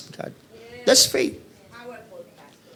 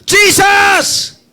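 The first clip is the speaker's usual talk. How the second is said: much higher, louder desperate cry; blind man's shout; 12 semitones higher, 6 dB louder than usual. Acted. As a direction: yell the name over the crowd. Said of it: "lalo siyang sumigaw". (sigaw ng bulag kay Hesus)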